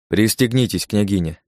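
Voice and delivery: ordinary delivery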